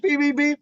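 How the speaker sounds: high-pitched beep noises; imitated beeping. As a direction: mimic a horn, high and quick. Imitating car horns while telling story.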